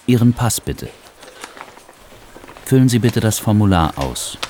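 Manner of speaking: ordinary talk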